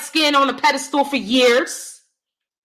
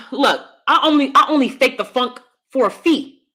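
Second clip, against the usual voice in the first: ordinary talk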